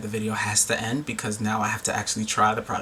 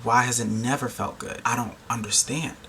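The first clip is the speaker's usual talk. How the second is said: ordinary delivery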